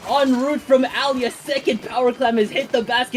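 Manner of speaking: high-pitched live esports call; rising game commentary held at a high pitch; excited play-by-play. Real, not acted. Live play-by-play of a big push.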